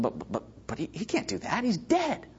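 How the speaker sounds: hushed, stammering protest climbing high; baffled student. Retold or imitated. Junior highers objecting to youth leader's graveyard plan, imitated bafflement. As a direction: imitate a flustered kid objecting in a small voice.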